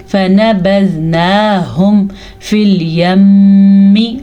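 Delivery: slow measured recitation, long hummed m in "الْيَمِّ"; Quranic recitation with ghunnah. Shown as a demonstration. Teacher reciting to explain ghunnah, "الْيَمِّ" slow with held m. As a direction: hold the nasal m of "الْيَمِّ". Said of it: "اب میم پہ غنہ ہوگا".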